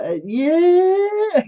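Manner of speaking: loud, high, long-held yell; hammed-up celebration against everyone's shock; gleeful cheer. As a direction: blurt out a huge drawn-out cheer. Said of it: "and I'm like".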